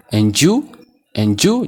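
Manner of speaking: AND YOU run together as "en-joo", rising; linked pronunciation. Shown as a demonstration. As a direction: blend the d and y of And you into j. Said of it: "jettanii pronounce gochuu qabdu". ("And you" akka "injuu"tti)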